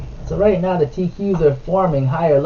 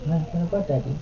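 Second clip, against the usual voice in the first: ordinary talk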